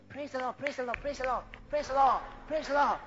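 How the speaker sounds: soft, mumbled mock praise; weak, timid fellow. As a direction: imitate a feeble, half-hearted worshipper. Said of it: "weak fellow coming in and say".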